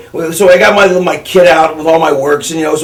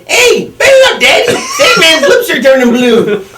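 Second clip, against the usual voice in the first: high squealing laughing mock shout; imitating Fonzie; 10 semitones higher than usual. Friend Fonzie yells from the back seat, high laughing shout. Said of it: "he's just hilarious the way he says it".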